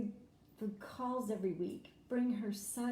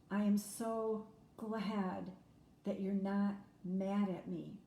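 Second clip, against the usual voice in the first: slow, drawn-out, lightly voiced mother; slow grateful mother; slower than usual. Adopted daughter quoting her mother's thanks, slowly drawn out. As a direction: stretch it out like a mother clinging to each word.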